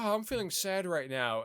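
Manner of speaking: high, frail mock-sad voice; lightly imitating a frail tech CEO. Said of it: "really frail talking voice".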